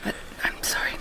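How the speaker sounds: hushed whisper of regret; whispered apology. Acted. Turning down her lover, whispered apology. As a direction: breathe the apology.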